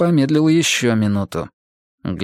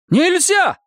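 high loud yell; 4 dB louder than usual. Acted. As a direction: yell to forbid it. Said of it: "заорала Зоя Петровна".

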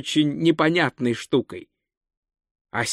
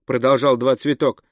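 ordinary delivery

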